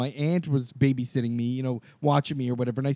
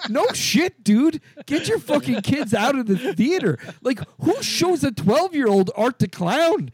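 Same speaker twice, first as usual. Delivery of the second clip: indignant rant; 8 semitones higher than usual. Retold or imitated. Moviegoer silently scolding dad for bringing kids, high indignant rant. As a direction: rant in exasperated disbelief, voice pushed up.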